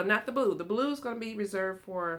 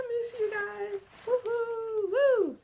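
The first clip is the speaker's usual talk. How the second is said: high cheer; 6 dB louder, slower than usual. Real, not acted. Greeting viewers after absence.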